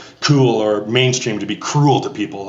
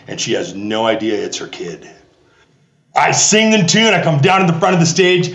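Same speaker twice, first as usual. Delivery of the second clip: usual speaking voice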